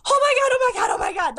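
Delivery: high, loud fangirl squeal; imitating excited fangirl. Young girl spotting Rey in Target, overexcited. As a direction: mimic a kid freaking out with excitement. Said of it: "She's so excited".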